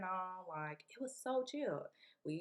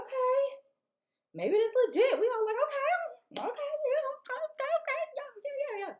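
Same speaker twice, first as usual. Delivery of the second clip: much higher and louder, giddy chatter; excited; 6 dB louder than usual. Retold or imitated. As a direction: babble with giddy excitement, up high. Excited at mansions.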